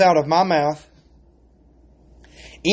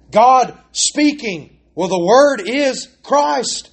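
preaching with rising fervor; 6 semitones higher than usual. Acted. Preacher building to climax.

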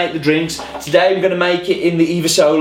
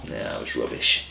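hushed aside; 12 dB quieter than usual. Pretend. Answers his own question aside.

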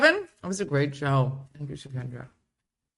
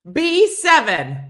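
slow, higher, louder call; bingo caller; 9 semitones higher, 9 dB louder, slower than usual. Pretend. Showing Addie how to run bingo for the kids, as a bingo caller. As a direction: announce it across the hall.

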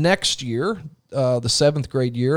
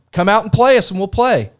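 raised, higher coach's challenge; retold yell; 6 semitones higher, 4 dB louder than usual. Rival coach calling to the other bench, yelling. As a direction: call it out like a taunt across the court. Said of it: "he yells down to me".

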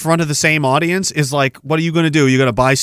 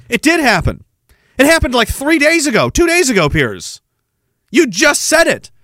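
higher, insistent disbelief; incredulous rebuttal; 9 semitones higher than usual. Real, not acted. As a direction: throw it back at him, exasperated. Mock rebuttal aimed at Piers.

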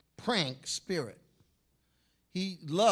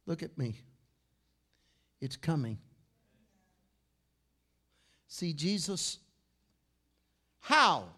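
ordinary talk, nothing marked

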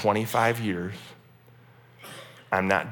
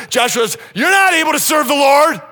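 high, loud shouted rebuke; Joshua doubling down; 10 dB louder than usual. Retold or imitated. Joshua rebuking the people, loud shout. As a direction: shout the warning at them. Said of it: "he doubles down and he said".

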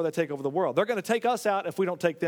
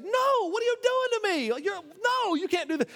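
high, stretched-out dismay of a thwarted king; frantic king; 12 semitones higher than usual. Retold or imitated. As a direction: whine at him like a cheated king. King Balak protesting the blessing.

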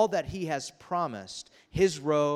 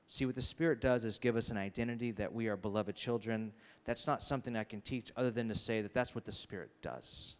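ordinary talk

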